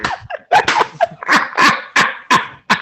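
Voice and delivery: breathless airy laugh, way up high; real laugh caught off guard by the story; high wheezing laugh. Real, not acted. Laughing at a co-host's shirtless theater story, high wheezing laugh.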